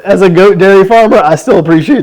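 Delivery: high, loud, laughing reply. Real, not acted. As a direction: squeal it through laughter. Reacting to joke poem.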